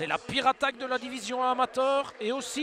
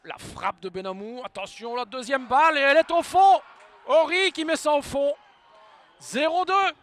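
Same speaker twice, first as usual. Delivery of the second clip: excited sports commentary; 3 semitones higher, 7 dB louder than usual. Real, not acted. Second goal called out.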